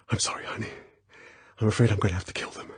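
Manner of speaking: soft, fast whisper. Acted. Bond whispering to the girl before the fight, fast whisper. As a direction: whisper close to her ear. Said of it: "He brought her close to him and whispered".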